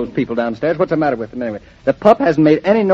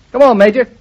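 louder, higher call to the dog; coaxing call; 8 semitones higher, 5 dB louder, faster than usual. Real, not acted. Husband urging the dog to greet mother, with a loud coaxing call. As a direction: coax the dog over with a bright call.

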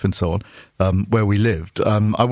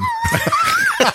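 burst of laughter. Real, not acted. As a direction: crack up at your own story. Laughing at own wife story.

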